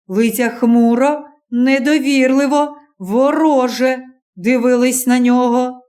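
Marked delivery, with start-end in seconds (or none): none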